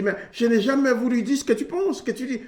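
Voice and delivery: lightly acted husband insisting he was misunderstood; defensive protest. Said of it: "Après, il dit".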